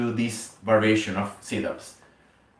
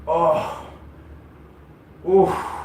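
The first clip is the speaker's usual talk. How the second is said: effortful grunts; 6 semitones higher, 4 dB louder than usual. Real, not acted. Straining through sit-up reps.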